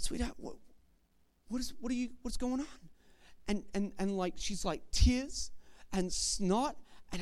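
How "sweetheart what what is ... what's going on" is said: soft, hushed, tender "Sweetheart"; gentle question. Retold or imitated. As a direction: ask the upset child softly. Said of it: "I said".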